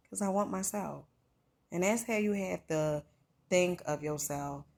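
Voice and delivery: usual speaking voice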